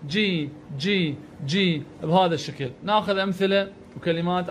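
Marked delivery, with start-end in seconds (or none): 0.1-1.8 slow, drawn-out "جي" as "jee"; kasra sound. Shown as a demonstration. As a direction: stretch the "ee" in each "جي". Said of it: "بالكسرة".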